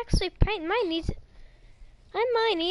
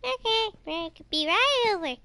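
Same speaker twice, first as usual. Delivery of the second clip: Mangle voice; 5 semitones higher than usual. Pretend. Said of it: "Let me just give her a little call".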